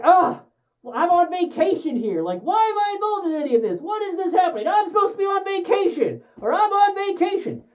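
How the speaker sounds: high whiny mock-exasperated complaint; exasperated whine. McClane whining he's on vacation, high exasperated whine. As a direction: whine it like a fed-up hero. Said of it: "exasperatedly shouts".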